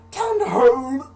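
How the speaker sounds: very high, very loud panicked shriek; screaming mom. Retold or imitated. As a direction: scream at them to hurry. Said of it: "my mom's screaming from the bathroom".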